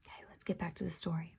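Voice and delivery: breathy whisper to the class, quick. Real, not acted. Teacher to her class, a quick whisper. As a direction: hush down and slip back into the story.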